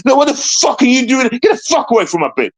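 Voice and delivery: high, indignant retold challenge; angry outburst. Patient in bed to police officer, angry outburst. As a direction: order them away with rising outrage. Repeats his exact words to police.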